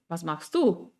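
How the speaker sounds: du stressed and rising; quick rise. Shown as a demonstration. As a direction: push the rise onto du. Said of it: "Son diferentes tonos y diferentes melodías".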